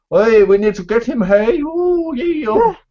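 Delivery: mock Irish accent, high; hammed-up Irish accent. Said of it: "I hate this fake Irish accent".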